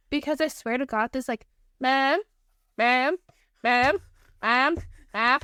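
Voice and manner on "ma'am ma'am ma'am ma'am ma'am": repeated nagging ma'am, rising each time; insistent little kid tugging for attention; whiny pestering kid. Retold or imitated. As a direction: pester like a seagull.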